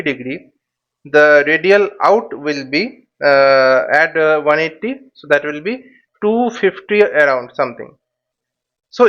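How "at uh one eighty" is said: ordinary talk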